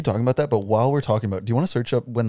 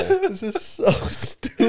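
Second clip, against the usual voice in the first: laughing; 13 semitones higher, slower than usual. Real, not acted. Laughing at the host.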